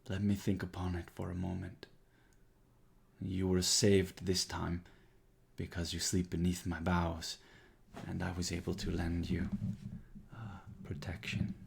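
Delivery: lightly voiced ancient tree, grave and caring; Thorn Mother speaking. Thorn Mother tells the player why they were spared, lightly voiced.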